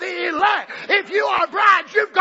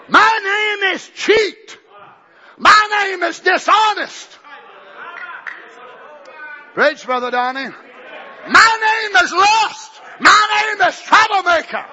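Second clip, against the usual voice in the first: shouted confession; 4 semitones higher, 3 dB louder than usual. Acted. Confessions voiced.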